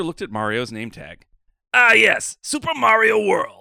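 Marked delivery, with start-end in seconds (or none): 1.7-3.6 a high, loud mock announcement; delighted director. Director reading Mario's name tag, loud and high. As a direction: proclaim the name like a grand title.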